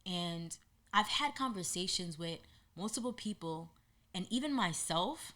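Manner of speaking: ordinary talk, nothing marked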